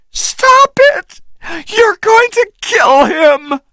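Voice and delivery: extremely high frantic shout. A narrator's reading. Anne pleading with Engelhorn, frantic shout. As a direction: plead to save him. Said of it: "she shouted".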